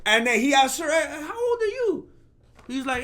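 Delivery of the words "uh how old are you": woman's voice, pitched up; high-pitched woman's voice. Pretend. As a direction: ask it in a high lady's voice. Woman asks his age.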